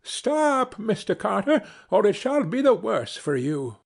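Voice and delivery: urgent high cry to halt. A narrator's reading. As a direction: call out a warning in a high sparrow voice. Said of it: "cried out".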